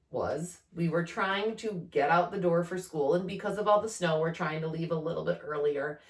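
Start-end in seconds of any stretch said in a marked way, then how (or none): none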